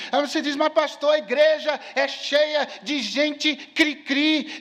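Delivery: high, driving preacher's voice, held up phrase after phrase; impassioned preaching. Acted. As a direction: preach with full force to the congregation. Preaches at full force. (pregação inflamada, em tom alto)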